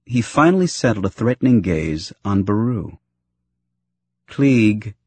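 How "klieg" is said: a short warning by name. A narrator's reading. Wife heading off her mischievous husband, warning. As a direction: caution him with just the name. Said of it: "Shmi was quick to warn".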